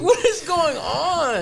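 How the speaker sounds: loud, high, drawn-out mock outcry; exasperated bafflement. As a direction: stretch it out in hammed-up disbelief. Acted bafflement at hat sizing.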